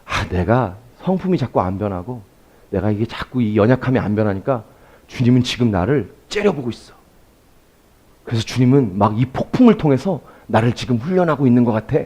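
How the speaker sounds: softly voicing a fearful believer; hushed, worried self-talk; anxious inner voice. Preacher voicing a believer afraid of God, softly. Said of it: "편하게 풀어서 설명해 보는 거예요".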